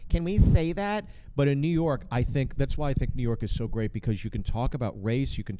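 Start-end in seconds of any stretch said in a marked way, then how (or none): 0.1-1.0 mock shocked question. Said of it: "a few where it's like".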